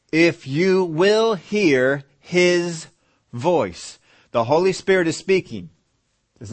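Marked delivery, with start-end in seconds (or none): 0.1-4.0 drawn out, each word weighed; very slow, deliberate scripture; slow emphatic reading. Shown as a demonstration. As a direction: stretch it so they hear "will". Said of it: "slow emphatic reread of verse".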